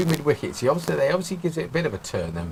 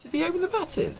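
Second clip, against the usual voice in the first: surprised; 4 dB quieter than usual. Real, not acted. Hearing surprising news.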